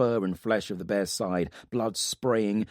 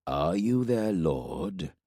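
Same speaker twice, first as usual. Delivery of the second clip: menacing warlord from the shadows; much slower than usual. Acted. Ildair questions Corbin.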